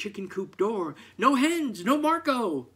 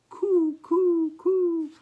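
high cooing hen sounds, much higher; 14 semitones higher, 8 dB louder, slower than usual. Acted. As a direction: coo like a sleepy hen, high and light. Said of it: "heard soft cooing".

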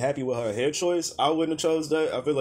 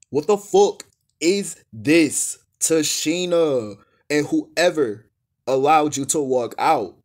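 very slow, louder, each word stretched; incredulous drawn-out rant; slow outrage; 5 dB louder, much slower than usual. Real, not acted. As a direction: spell out the disgust word by word.

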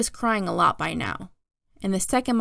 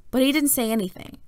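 plain reading voice

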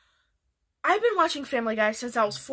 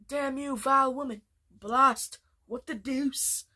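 lightly voiced snooty cartoon baby; a hint of Stewie's haughty villain tone; Stewie Griffin. Fan doing Stewie Griffin for viewers, lightly voiced.

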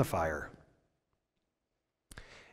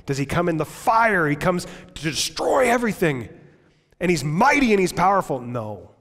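ordinary talk

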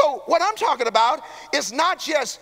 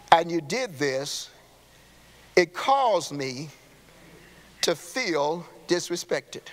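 calm communicating; 8 semitones lower, 4 dB quieter, slower than usual. Shown as a demonstration. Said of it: "I'm talking about communicating".

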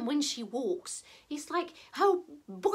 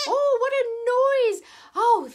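put-on loud, high old lady outcry; exasperated old lady; 6 semitones higher, 6 dB louder than usual. Old lady amid the hen's racket, exasperated. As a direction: exclaim over the din, pitched up.

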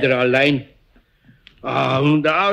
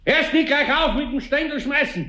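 higher-pitched call out the window; scolding call; 9 semitones higher than usual. Acted. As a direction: tell the brat off through the window. Scolding child throwing stones. (schimpft durchs Fenster zur Charlotte hinaus)

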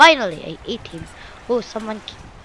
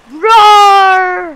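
loud, high, drawn-out roar; big mock-fierce roar; pretend shark roar; 4 semitones higher, 6 dB louder than usual. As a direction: roar like a playful monster. Roaring as the shark.

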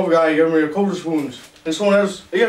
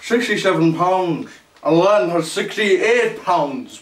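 usual speaking voice

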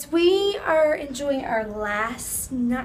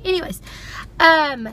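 normal talking voice

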